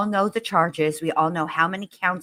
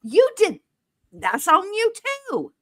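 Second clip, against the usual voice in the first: much higher, loud, incredulous rant; furious disbelief; 12 semitones higher, 4 dB louder than usual. Real, not acted. Cohost ranting at the killer over his statement, high furious disbelief. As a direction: let the outrage climb to a near-yell.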